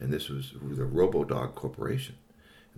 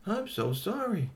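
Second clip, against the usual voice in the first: sympathetic; 11 semitones higher, slower than usual. Acted.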